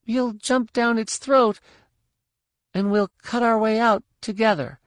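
put-on high hesitant child voice; little girl voice. A narrator's reading. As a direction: answer like a brave little girl.

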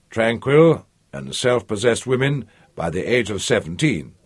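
ordinary delivery, nothing marked

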